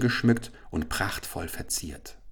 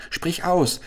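much higher urgent cry; eager shout; 10 semitones higher than usual. Acted. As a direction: press her eagerly to speak. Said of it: "schrie Chasid". (hoher drängender Ruf)

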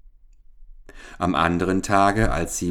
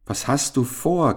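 raised, higher-pitched storyteller's call; mildly calling out; 8 semitones higher than usual. A narrator's reading. Unseen frog asks the wailing princess what's wrong, mildly calling out. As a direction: call over to someone, lightly acted. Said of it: "rief ihr jemand zu".

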